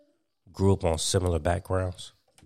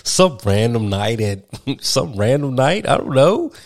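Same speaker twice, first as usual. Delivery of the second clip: laughing; 6 semitones higher, 8 dB louder than usual. Real, not acted. Both laughing over the quote.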